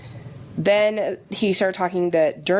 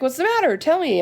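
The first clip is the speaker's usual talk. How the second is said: much higher, gently prying nurse voice; coaxing nurse; 9 semitones higher than usual. Retold or imitated. Nurse coaxing the tearful patient, in a much higher voice. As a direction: coax the patient to open up, pitched up.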